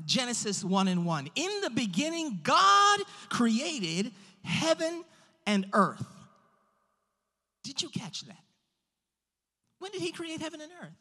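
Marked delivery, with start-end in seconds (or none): 0.0-6.0 forceful pulpit encouragement; emphatic preaching. Real, not acted. Preacher encouraging the congregation about a limitless God, emphatic preaching. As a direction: preach it with drawn-out key words and big pauses. Declaims scripture.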